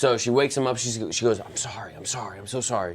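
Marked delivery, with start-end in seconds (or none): 1.4-2.9 mildly voiced apologetic mother, hushed; soft apology. Retelling mother apologizing to son.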